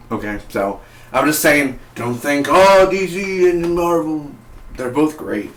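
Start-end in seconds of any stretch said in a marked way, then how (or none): 2.5-5.5 drawn-out, higher, louder mock whine; mock fan reaction. Reviewer mocking fans who compare DC and Marvel, exaggerated. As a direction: mimic a fan fussing over rivals. Mimics fans picking sides.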